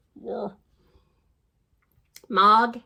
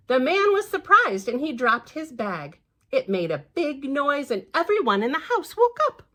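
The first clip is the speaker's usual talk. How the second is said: ordinary delivery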